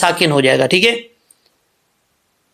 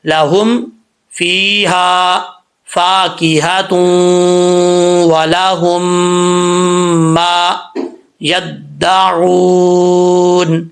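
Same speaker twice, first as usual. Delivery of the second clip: slow melodic tajweed recitation; teacher leads practice recitation; Quran recitation practice; much slower than usual. Shown as a demonstration. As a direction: recite slowly for students to repeat. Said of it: "اب آپ نے میرے ساتھ practice کرنی ہے".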